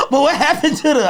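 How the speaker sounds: ordinary delivery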